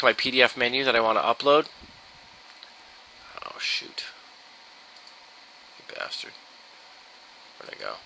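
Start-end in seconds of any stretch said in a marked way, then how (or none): 3.4-7.9 very soft, low mutter to oneself; muttered aside. Real, not acted. Tutorial presenter cursing to himself while selecting a menu file, muttered. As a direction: grumble under the breath, barely audible. Muttering to self, upload going wrong.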